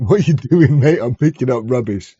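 mock London accent. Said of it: "don't laugh at your own jokes".

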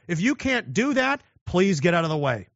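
ordinary delivery

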